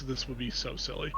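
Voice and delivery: ordinary talk, nothing marked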